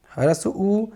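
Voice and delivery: ordinary talk, nothing marked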